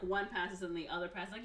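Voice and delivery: ordinary talk, nothing marked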